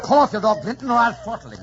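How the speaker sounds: loud threat. Acted. Heathcliff threatens Linton.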